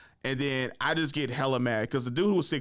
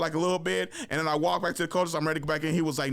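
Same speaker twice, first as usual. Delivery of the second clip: usual speaking voice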